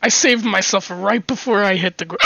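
normal talking voice

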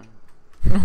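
laugh. Real, not acted. Laughing at the tango joke.

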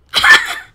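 high shriek of laughter. Real, not acted. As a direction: burst into a squealing laugh. Survives near death.

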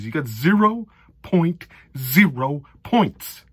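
mock Animal House verdict. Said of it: "Animal House".

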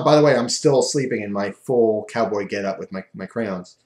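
usual speaking voice

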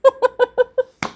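high, loud gleeful giggle; creepy giggle. Acted. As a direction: giggle with eerie delight. Killer laughs at waking captive. (섬뜩한 킥킥 웃음)

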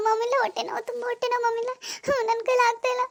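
high, strained voice crying out in pain; moaning in pain, pleading with her mother-in-law. Acted. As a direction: beg for help through stomach cramps. Acted stomach pain.

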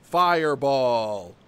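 loud, slow drawn-out call; slow booming command shout; spell shout. Real, not acted. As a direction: bellow the spell name at the game.

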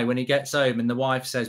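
usual speaking voice

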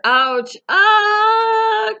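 high, loud "ouch" into a long wail; loud acted falling scream; yelp and scream. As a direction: cry out as if tumbling downhill. Tumbling down the hill in story.

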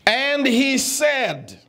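AND HE SAID stretched very slow and high; emphatic drawn-out reading. Shown as a demonstration. Teacher showing the class verse two's third word, emphatic and slow. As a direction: draw out each word of AND HE SAID, landing on SAID.

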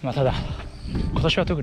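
jogging and talking; slightly breathless mid-run; running while talking. Real, not acted. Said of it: "今六キロ走ってます。".

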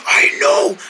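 high, strained rasp of a whisper; lost voice. Pretend. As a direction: agree with no voice left. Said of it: "I'm afraid you lost your voice".

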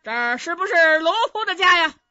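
loud, high barging-in shout; arrogant yell. Acted. General barges into the inn, yelling for the owner. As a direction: bellow it across the inn like a bully. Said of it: "冯子都可嚷上了".

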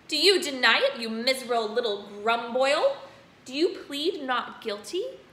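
loud, menacing demand; furious headmistress. Acted. Headmistress demanding Bruce confess, loud and menacing. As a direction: interrogate the boy harshly. Trunchbull interrogating.

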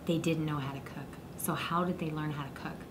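secretive hushed whisper. Acted. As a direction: lean in and whisper it. Host whispers to kids.

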